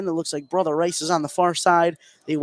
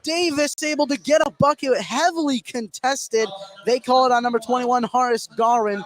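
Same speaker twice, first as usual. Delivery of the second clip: excited call; 7 semitones higher than usual. Real, not acted. Calling Davis's contested bucket.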